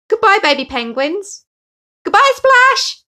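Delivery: high, loud shouted farewells; joyful shout. Acted. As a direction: shout goodbye from the boat. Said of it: "he shouted".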